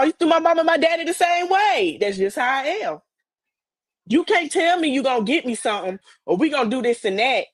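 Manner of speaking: normal talking voice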